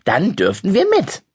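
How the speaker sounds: put-on a kid holding the grown-up to the deal; young boy accusing his older cousin; indignant boy.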